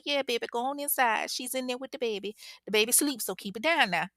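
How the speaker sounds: a gentle grandma waving them in; motherly Reva. Retold or imitated. Reva telling the girls to go in, kindly motherly. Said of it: "she tells her".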